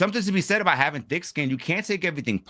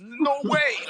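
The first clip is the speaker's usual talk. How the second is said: mock disbelief. Real, not acted.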